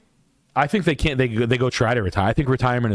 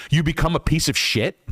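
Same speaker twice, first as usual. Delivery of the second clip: usual speaking voice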